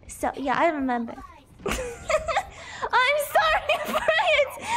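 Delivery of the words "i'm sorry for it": laughing through an apology; giggling apology. Real, not acted.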